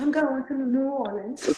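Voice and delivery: high, laughing through "New Orleans". Real, not acted. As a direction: laugh while trying the word. Laughing over pronunciation attempt.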